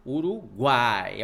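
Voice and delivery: "Uruguai" slow, with a loud, forceful GUAI; stress on GUAI. Shown as a demonstration. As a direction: push the A in "Uruguai" hard, stretching the last syllable. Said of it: "força expiratória".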